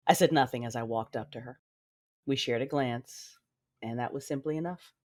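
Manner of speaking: low, measured first-person storytelling; story narration. Acted. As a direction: narrate it low and restrained, falling at phrase ends.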